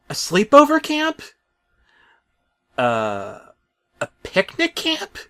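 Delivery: put-on high, slow little-kid voice; small child. Talking truck asking Bob, in an excited high child voice. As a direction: ask it like an eager small child.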